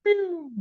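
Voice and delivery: bomb sound effect. Retold or imitated. Imitating a bomb flattening a place.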